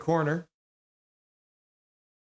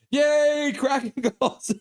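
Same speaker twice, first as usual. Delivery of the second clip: excited cheer; 11 semitones higher, 4 dB louder, slower than usual. Real, not acted. Cheering a goal.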